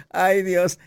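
laughing sigh of self-mockery. Real, not acted. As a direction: chuckle at your own rambling. Laughs at her own chattering.

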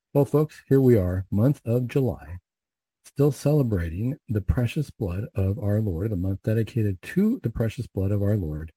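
ordinary delivery

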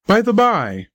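exclaiming. A narrator's reading. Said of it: "cried my father".

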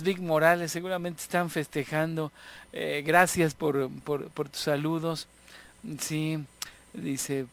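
ordinary talk, nothing marked

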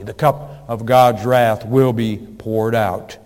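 usual speaking voice

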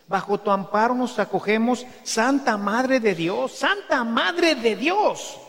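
reciting the prayer to Mary, fervent; prayer recited. Shown as a demonstration. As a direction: lift it up as a devout prayer. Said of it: "Por eso yo la rezo".

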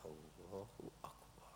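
slow, awed whisper; hushed takbir. Acted. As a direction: whisper it as if entering prayer. Voices entering the prayer.

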